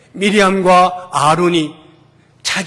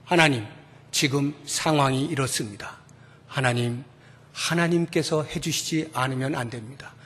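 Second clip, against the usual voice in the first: humble prayer; 7 semitones lower, 10 dB quieter than usual. Retold or imitated. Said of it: "몽땅 들고 하나님 앞에 나아가는 거예요".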